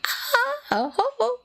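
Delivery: high shrill cackle of a madwoman; mock crazed giggle; crazy laugh. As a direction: cackle like a lunatic. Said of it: "se empieza a reír".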